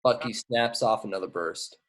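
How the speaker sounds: ordinary talk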